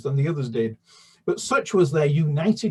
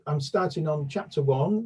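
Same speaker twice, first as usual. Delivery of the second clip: ordinary delivery, nothing marked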